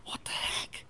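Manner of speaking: whispered what the heck. Retold or imitated. Man still seeing blurry faces, whispering confused. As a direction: hiss it under your breath, baffled. Confused aside in prayer line.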